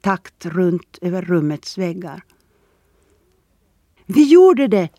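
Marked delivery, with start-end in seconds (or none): 4.1-4.9 high, loud exultant cry; triumphant shout. A narrator's reading. Boy to Erik about their finished work, triumphant shout. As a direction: call out the victory loudly. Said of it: "ropade Boy och skrattade högt".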